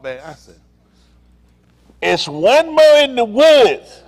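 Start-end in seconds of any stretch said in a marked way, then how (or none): 2.0-4.0 slow, high, loud drawn-out call; long drawn-out shout. Retold or imitated. Man calling out to police, long loud holler. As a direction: stretch it out and holler it.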